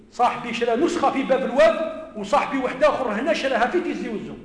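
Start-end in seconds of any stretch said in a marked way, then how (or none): none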